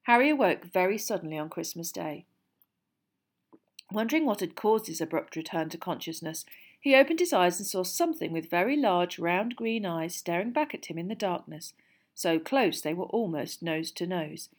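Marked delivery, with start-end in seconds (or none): none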